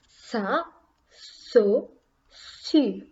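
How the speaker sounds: "sa, so, su" as separate syllables with hissed s; soft S sound. Shown as a demonstration. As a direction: model "sa, so, su" one by one. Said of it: "So the sound changes".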